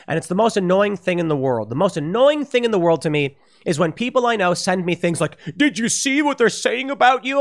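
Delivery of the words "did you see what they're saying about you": high, nosy quoting voice; mock gossipy friend. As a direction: tease it like a busybody passing on gossip. Mocking a friend's gossipy message.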